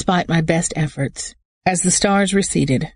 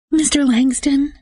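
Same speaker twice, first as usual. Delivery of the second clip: higher, bright receptionist voice; bank clerk; 10 semitones higher than usual. A narrator's reading. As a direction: sound like a polite bank caller.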